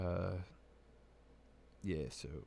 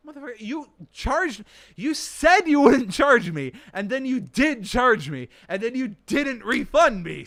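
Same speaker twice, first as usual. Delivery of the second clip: loud, much higher, slow yelling at the company; mock furious yell; 13 semitones higher, 15 dB louder than usual. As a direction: scream the complaint at them.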